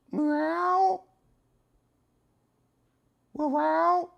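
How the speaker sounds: loud high kitten mew; loud cat meow. Pretend. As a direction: call out like a yowling kitten. Meowing at cat, mimicking.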